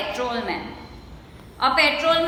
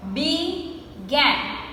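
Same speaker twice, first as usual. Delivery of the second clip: "Began" split as bee... gan; two separated beats on "Began"; slowly broken up. Shown as a demonstration. Teacher counting two syllables, "Began" slowly broken up. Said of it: "we say it slowly and we break the word".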